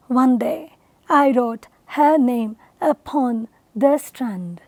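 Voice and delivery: ONE DAY, I WROTE, HER NAME, UPON, THE STRAND in separated pairs; low measured verse, pausing after each two syllables; stress pattern demo. Shown as a demonstration. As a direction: recite the line foot by foot, dropping away on STRAND. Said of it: "notice the stress pattern in it".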